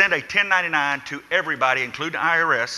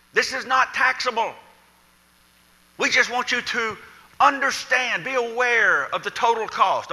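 higher, lightly voiced official reassurance; lightly mocking the IRS's official wording; quoting the IRS; 8 semitones higher than usual. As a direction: mimic the IRS line, a touch prim. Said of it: "I R S has been emphatic".

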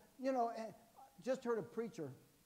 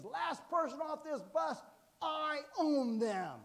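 higher, slow, louder sergeant's threat; drill sergeant bark; 9 semitones higher, 6 dB louder, slower than usual. Retold or imitated. Sergeant on the bus threatens recruits, slow higher bark. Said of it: "He scared the daylights out of me".

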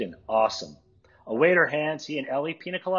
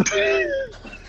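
high laugh. Real, not acted. Narrator laughs after line.

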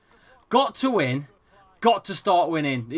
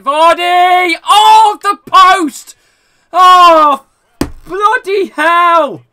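real, full-volume anguished yell, pitched very high; agonised shouting; 13 semitones higher, 15 dB louder, much slower than usual. Real, not acted. Gamer watches Vardy hit the post, agonised shouting. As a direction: scream at a near miss, stretching the words.

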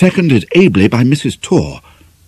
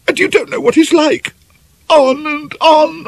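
high, tearful and pleading throughout. Acted. Ivy in tears, pleading with the angry doctor. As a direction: beg him through tears to understand. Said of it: "Ivy's tears fell thicker and faster".

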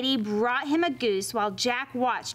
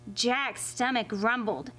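ordinary delivery

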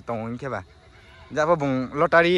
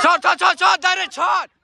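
frantic mock sports commentary; 11 semitones higher, 3 dB louder, slower than usual. Real, not acted. Shouting during football game play.

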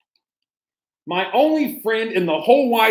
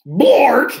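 loud bork; 5 semitones higher, 5 dB louder than usual. Acted. Reader blurts the silly word to the kids, loud. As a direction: punch out Bork loud and high. Reading nonsense word aloud.

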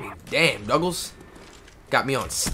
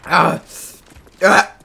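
ordinary talk

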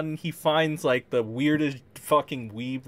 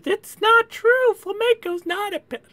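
mock high voice; 5 dB louder than usual. Mocking Flamenco's defenders.

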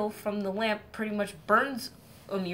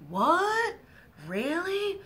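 much higher, alarmed squeak of surprise; shocked disbelief; 11 semitones higher, much slower than usual. Acted. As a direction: react as if the news just hit. Psyche hears husband is monster.

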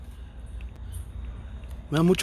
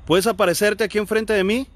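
raised, higher-pitched request; calling out; 5 semitones higher, 4 dB louder than usual. Real, not acted. Investigator asking a spirit to appear, calling out. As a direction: call out clearly to someone unseen.

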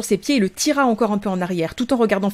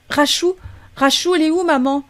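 put-on high, worried small-child voice; anxious little boy; 8 semitones higher, 3 dB louder than usual. Blake asks his sister where mum is, high and anxious. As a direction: ask for mum like a scared child.

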